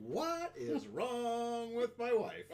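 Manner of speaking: slow, high and laughing; baffled laugh through words; slow laughing disbelief. Retold or imitated. Husband's inner thought, laughing.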